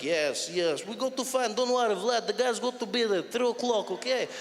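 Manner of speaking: put-on Russian-accented English like a DJ mix; English scratched into Russian. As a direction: rattle off phone orders in a Russian accent. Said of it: "someone took a D J of English".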